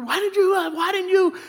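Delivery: high-pitched imitation of a protesting wife; mock wifely complaint. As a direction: imitate her demanding an explanation. Wife challenging him, acted.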